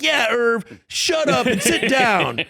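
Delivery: high, loud, drawn-out put-down; mock cocky shout. Guest feeling his oats tells off Irv, mock shout. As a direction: act out getting carried away and shouting someone down. Cocky retold outburst that silences room.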